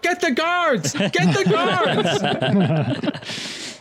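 very high-pitched frantic call; hammed-up high alarm; alarmed bystander. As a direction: call for help in comic panic. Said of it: "one of them grabs you".

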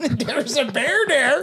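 put-on high, slow, laughing old lady; old woman voice. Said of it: "repeating the old woman line".

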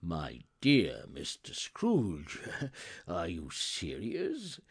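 low, slow disbelief with a laugh; astonished gentleman. Acted. Gentleman doubting Scrooge's generous offer, astonished. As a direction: draw it out in amazed disbelief.

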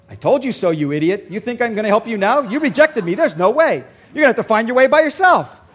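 hammed-up angry rant as the jilted sat-nav; mock scolding GPS lady. Imagined GPS lady scolding the driver.

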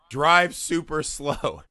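drawn-out, very slow words; slow instruction. Retold or imitated. Teen tells his buddy how to escape the angry driver, very slow and drawn out. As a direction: stretch each word out to show how slow.

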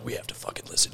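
urgent retold whisper. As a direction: hiss it under your breath. Retells his girlfriend's urgent demand.

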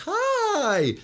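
high bright hi, sung up; a high, open greeting with empty hands; friendly hi. Shown as a demonstration. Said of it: "you're meeting me like this".

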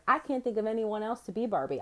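ordinary talk

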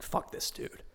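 whispered aside to the lady next to him; a whisper to stir up his seatmate; conspiratorial whisper. Retold or imitated. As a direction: hiss it so the front can't hear. Firing up old lady beside him.

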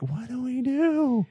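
high, drawn-out helpless wail; mock dismay. Filmmakers at a loss after losing their star, mock dismay. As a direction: stretch it out high in mock panic.

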